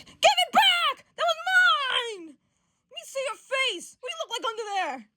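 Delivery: high mock tantrum, yelled then easing off; [name] losing it. [name] demanding his thing back, losing it. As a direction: yell it in a shrieking comic fit. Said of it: "[name]'s losing his shit over here".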